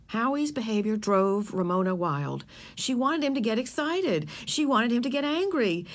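ordinary delivery, nothing marked

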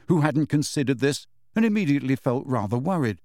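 ordinary delivery, nothing marked

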